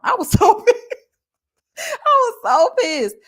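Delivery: high, laughing, half-crying words; laughing through it. Real, not acted. Laughing to viewers about how angry she was. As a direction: laugh while admitting the anger. Laughing while retelling anger.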